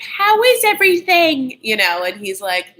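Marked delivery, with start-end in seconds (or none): 0.0-1.6 high, slow mock Cockney question; sister impression. Mimicking the sister asking Tom, high and slow. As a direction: coo it in a put-on high Cockney voice. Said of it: "so the sister is like".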